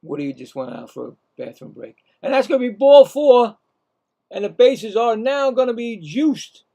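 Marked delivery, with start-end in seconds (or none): 2.2-6.6 loud, high play-by-play call on ball four; excited call. Real, not acted. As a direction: announce the bases loaded with energy. Calling ball four, bases loaded.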